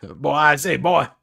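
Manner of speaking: loud, laughing cartoon Southern bluster; Foghorn Leghorn. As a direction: boom it out in a joking Southern voice. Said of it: "talks like Foghorn Leghorn".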